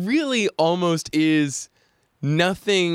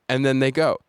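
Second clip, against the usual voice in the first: ordinary talk, nothing marked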